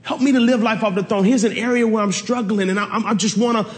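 light the fire, voice lifted high; fired-up preaching. Acted. Paul pleading, quoted believer.

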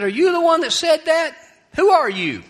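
loud, high-pitched demand; incredulous burglar. Acted. Burglar confronts a parrot in a cage, loudly incredulous. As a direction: confront the culprit in loud disbelief.

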